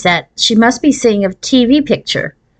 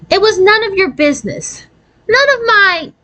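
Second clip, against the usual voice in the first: embarrassed anger; 12 semitones higher than usual. A narrator's reading. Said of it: "Gretchen felt her face burn".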